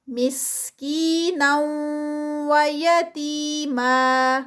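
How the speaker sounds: مسکینا و یتیما recited very slowly, pausing between words; very slow, held مسکینا; slow recitation. Shown as a demonstration. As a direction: take it slowly, word by word. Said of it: "بہت روک کے آہستہ آہستہ".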